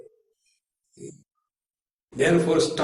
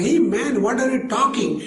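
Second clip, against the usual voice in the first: high, baffled retort; baffled listener; 7 semitones higher, faster than usual. Retold or imitated. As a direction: cut him off, puzzled. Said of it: "That person".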